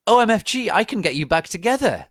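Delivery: high, loud and fast, bursting with glee; excited squeal. A narrator's reading. Narrator squealing to Satan about getting back together, high and loud. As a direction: squeal it out like a thrilled fangirl.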